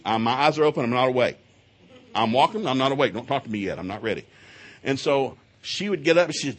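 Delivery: ordinary delivery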